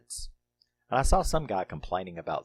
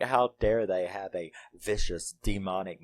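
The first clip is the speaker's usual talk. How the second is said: ordinary talk, nothing marked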